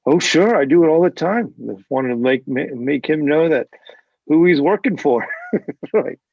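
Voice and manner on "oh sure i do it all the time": loud, cheerful and offhand, as if it were nothing; tossing it off casually at full volume; breezy retold boast. Uncle brushing off his nephew's surprise, loud breezy tone. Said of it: "He's like".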